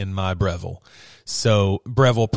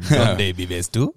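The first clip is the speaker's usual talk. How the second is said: mock Spanish; 2 semitones lower, much slower than usual. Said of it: "four semesters of college Spanish".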